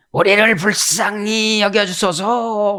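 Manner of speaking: slow, pleading cry for mercy; mild plea. Retold or imitated. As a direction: ask for mercy, drawn out and humble. Two blind men pleading. (자비를 구하는 맹인들)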